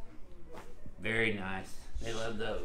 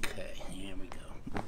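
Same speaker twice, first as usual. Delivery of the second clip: whispering; 4 semitones lower, 5 dB quieter than usual. Real, not acted. Quiet cue before adjustment.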